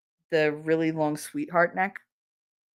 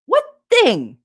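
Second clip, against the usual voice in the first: high, loud mock-clueless question; feigned innocence; 12 semitones higher, 7 dB louder than usual. Wife pretends not to get husband's hint, loudly mock-clueless. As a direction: play dumb, pitched way up. Feigned confusion in retold quarrel.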